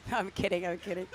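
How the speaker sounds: ordinary talk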